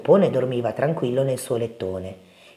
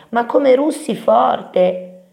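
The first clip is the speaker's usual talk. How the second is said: high, loud, drawn-out complaint; little mouse wailing about the noise; Topino che grida; 9 semitones higher, 6 dB louder, much slower than usual. A narrator's reading. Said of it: "gridò Topino". (lamentela acuta e strascicata)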